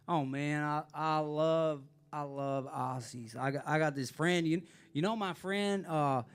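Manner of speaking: imitating a friendly Nashville landlord in a Southern accent; slow, chatty Southern accent. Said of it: "do these accents".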